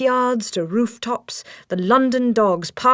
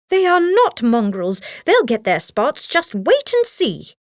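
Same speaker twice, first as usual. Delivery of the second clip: indignant exclaim; 5 semitones higher than usual. A narrator's reading. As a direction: defend the puppies hotly. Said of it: "Nanny exclaimed".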